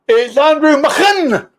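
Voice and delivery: high, drawn-out caller announcement; big slow build onto the surname; showy announcer introduction. Pretend. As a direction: stretch the name out like a ring announcer. Host announces the caller by name.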